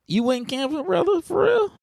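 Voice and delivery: can't believe it; very high, amazed for real; surprised. Real, not acted. Surprised question.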